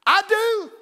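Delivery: high, loud vow; hammed-up shouted answer; emphatic declaration. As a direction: belt out the wedding vow. Quotes phrase from marriage counseling.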